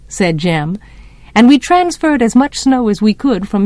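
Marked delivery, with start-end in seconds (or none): none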